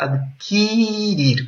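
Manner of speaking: "adquirir" with the middle i stretched long; drawn-out vowel. Shown as a demonstration. Teacher points students to the root vowel, "adquirir" with long drawn i. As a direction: hold the i, ad-keeee-REER, in "adquirir". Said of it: "Una I se transformó".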